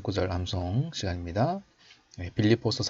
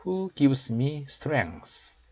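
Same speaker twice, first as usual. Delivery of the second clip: slow, raised teaching voice; slow model reading; 5 semitones higher, much slower than usual. Shown as a demonstration. Teacher showing learners the English verse, slow model reading. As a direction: read it out slowly for learners to copy. Models last part for practice.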